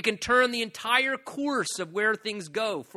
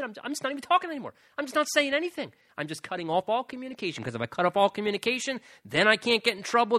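fast, indignant rattle; hurried, acted-out sulk; mock stubborn refusal; 4 semitones higher, 3 dB louder, faster than usual. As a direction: imitate someone shutting down the conversation in a huff. Acts out someone refusing to talk.

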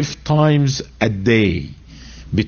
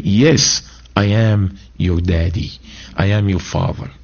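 God speaking tenderly; 6 semitones lower than usual. Retold or imitated. God's voice, tender.

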